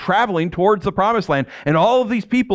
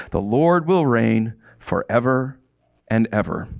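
scripture reading; 5 semitones lower than usual. Acted. Reading Israel's song to the congregation, solemn and slowing. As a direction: read the victory song with growing reverence.

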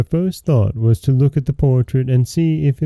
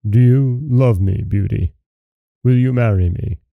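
gruff beast voice. A narrator's reading. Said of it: "said in his gruff voice".

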